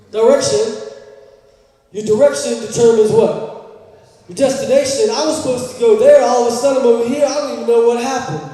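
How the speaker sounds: full-voiced pulpit preaching; preaching loud. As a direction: push it like a sermon climax. Preacher building to loud point.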